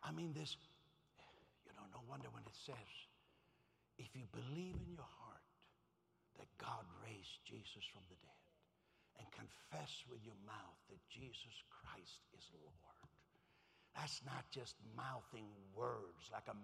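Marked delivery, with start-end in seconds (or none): 4.0-12.8 low, soft, near-whispered verse; quiet, solemn recitation; hushed scripture. Real, not acted. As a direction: recite it slowly and reverently.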